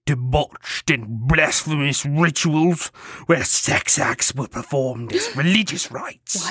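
slow, whispery horrified friar; scandalized friar. Acted. Spanish friar describing sex rituals, scandalized whispery voice. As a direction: intone it in a dramatic scandalized whisper.

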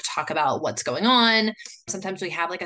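ordinary talk

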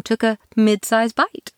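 usual speaking voice

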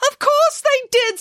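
squeaky high, slow, insistent; high pig nanny voice. Acted. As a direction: play her as a bossy little pig. Nanny Piggins insists to children.

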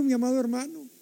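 ordinary talk

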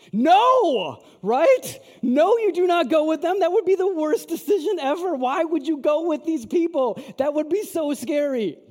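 high, loud, alarmed outburst; emphatic shout. Acted. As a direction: shout it as an obvious, horrified no. Exaggerated answer to own question.

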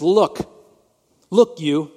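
loud, curt retort of a sulky son; mock angry retort. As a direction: throw "Look you" at him with disdain. Older son snaps at father.

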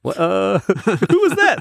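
high, slow mock bewilderment; alarmed patient. Patient reacts to the anesthesiologist, mock alarm. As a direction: act a baffled patient, voice shooting up.

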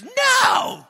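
put-on very high, loud child's shout; little girl refusing her mom, shouting; defiant little girl. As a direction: yell no like a defiant little kid. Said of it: "And she goes".

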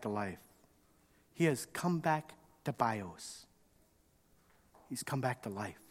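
tender father. Acted. Father answering the resentful older son, gentle and soft. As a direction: ease his son's anger softly. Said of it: "The father says".

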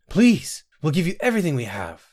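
high, urgent, quickening plea; gasped plea. A narrator's reading. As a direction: beg desperately, leaping up in pitch. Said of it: "Sinetta gasped".

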